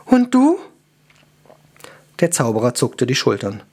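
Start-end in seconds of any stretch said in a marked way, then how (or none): 0.0-0.6 high, loud, exasperated question; despairing. A narrator's reading. Elf turns to a companion for help, despairing. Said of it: "Verzweifelnd sah die Elfe Gestern an".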